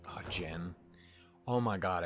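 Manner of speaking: ordinary talk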